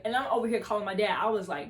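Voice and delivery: ordinary talk, nothing marked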